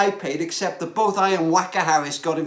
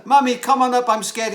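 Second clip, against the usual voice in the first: put-on child calling upstairs, a little louder; mock child shout; 4 semitones higher, 5 dB louder than usual. Scared boy calling his mother downstairs, mild mock shout. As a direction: call up the stairs like a scared boy. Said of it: "he shouted down to his mother".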